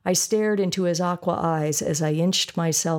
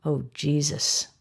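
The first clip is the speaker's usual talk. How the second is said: exhaled; 3 semitones lower, slower than usual. A narrator's reading. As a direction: breathe the words out. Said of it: "exhale the words".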